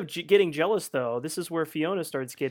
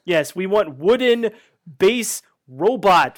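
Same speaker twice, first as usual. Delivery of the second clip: slow, louder, stretched mock director; 4 semitones higher, 9 dB louder, slower than usual. Director telling the actor to act robotic, slow mock command. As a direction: drag out wooden base robot like a pompous boss.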